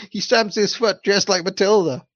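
high teasing giggly voice; playful mocking children; children laughing. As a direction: laugh through it like kids. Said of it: "they cried".

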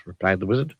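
ordinary delivery

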